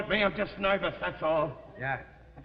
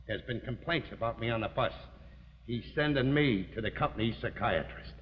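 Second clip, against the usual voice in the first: low, subdued voice; grave revelation; 9 semitones lower, 6 dB quieter than usual. Acted. Husband tells wife about the boss, grave and low. Grave confession after sarcasm.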